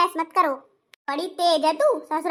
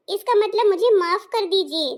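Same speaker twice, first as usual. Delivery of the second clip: ordinary talk, nothing marked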